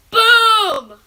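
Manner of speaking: high, loud boom; triumphant shout. Real, not acted. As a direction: shout it as the big ending. Story's finish.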